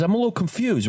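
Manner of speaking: mock puzzled dad; imitating his father's warning line; dad's phrase. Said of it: "he always goes".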